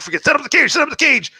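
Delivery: high urgent mock yell at the cage; dad yelling at the bird in a panic. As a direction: bark orders while grabbing the bird. Dad grabbing violent bird.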